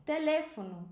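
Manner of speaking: "Teléfono" with LÉ high and loud; antepenultimate stress. Shown as a demonstration. Teacher introduces the Spanish word, stress on LÉ. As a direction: punch LÉ, let fono fall away. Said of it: "tem a força de pronunciação aqui".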